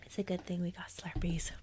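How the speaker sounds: hushed late-night whisper. Real, not acted. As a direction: whisper it softly.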